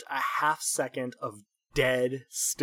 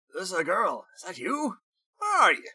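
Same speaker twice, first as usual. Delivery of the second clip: startled; 7 semitones higher, faster than usual. Acted. Startled man hears voice in head.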